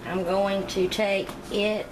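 normal talking voice

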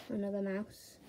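ordinary talk, nothing marked

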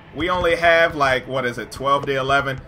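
normal talking voice

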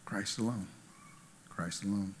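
hushed emphasis. Acted. Preacher closing the point to the congregation, hushed. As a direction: let it fall almost silent.

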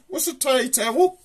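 a squeaky, puzzled question; baffled squeak. Retold or imitated. Telling the pub story, a squeaky confused question. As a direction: leap up high on "owl", baffled. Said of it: "I says".

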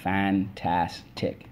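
"fantastic" stretched slowly as fan-tas-tic; syllable by syllable. Shown as a demonstration. Teacher leading the class, slowly syllable by syllable. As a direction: tap "fantastic" out slowly, one syllable per finger. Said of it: "let's go".

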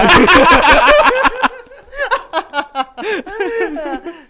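high, loud, long burst of laughter. Real, not acted. Tawa panjang. (tawa panjang yang keras)

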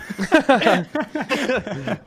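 high, sustained laughter; bursting laugh. Real, not acted. Laughing after asking everyone to manifest George's death, bursting laugh. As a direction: crack up at your own joke.

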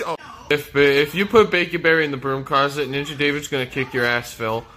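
ordinary talk

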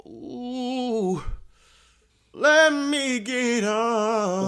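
very slow, stretched words; slow drawn-out order. Acted. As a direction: drag the order out. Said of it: "Order, you fucking fool".

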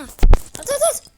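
loud, high panicked yelling; sudden high yell to throw it now; frantic shout. Real, not acted. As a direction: scream it in the heat of play.

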